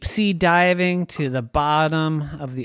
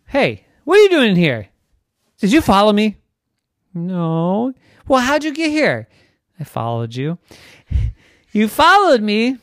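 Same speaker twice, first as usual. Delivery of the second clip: high, loud indignant questions; surprised; 8 semitones higher, 7 dB louder than usual. Acted. Trisha finding her sister in the cave, indignant. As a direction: caught off guard, demanding answers.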